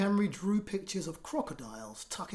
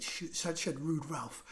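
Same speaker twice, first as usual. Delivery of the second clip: ordinary delivery, nothing marked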